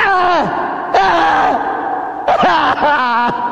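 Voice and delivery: high drawn-out screams, fading; acted cries of pain. Jesus being beaten at the post, acted screams of pain. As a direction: cry out long under the lash.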